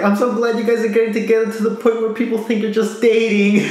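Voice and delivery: high-pitched retold gushing; mock gushing delight. Voicing gushing anime character.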